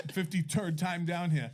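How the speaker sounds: mocking Rhode Island "turd" for third; Rhode Island accent. Repeating the tourist's words to doubtful friends, Rhode Island accent. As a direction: mimic a Providence local's accent. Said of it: "that's how he said it, 'cause that's how he talks".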